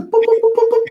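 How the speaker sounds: very high mock machine beeps; laser beeps. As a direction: chirp it like a surgery laser. New eye lenses going in.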